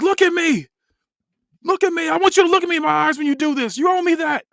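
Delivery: high, loud, full-force angry scream; screaming demand. Acted. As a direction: scream it at them, demanding their eyes. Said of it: "then scream".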